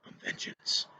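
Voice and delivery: whispered, very soft superhero imitation; Batman. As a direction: whisper it like a menacing masked vigilante. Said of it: "heard him talk as Batman".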